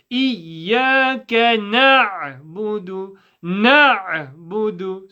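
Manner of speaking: slow Quranic recitation of إياك نعبد with a clear throat ayn. Shown as a demonstration. Teacher reciting slowly to students. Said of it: "So when we play the ayn".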